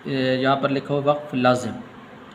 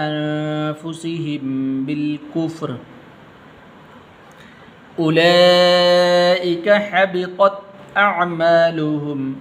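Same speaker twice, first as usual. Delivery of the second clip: slow melodic Quranic chant; Quran recitation; 4 semitones higher, 4 dB louder, much slower than usual. Shown as a demonstration. Teacher reciting the verse to students, measured chant. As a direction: recite the verse slowly with tajweed.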